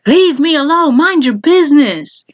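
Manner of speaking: re-enacted shout, not at full volume; retold shouting. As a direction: act out shouting at them to back off. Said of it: "Literally me shouting".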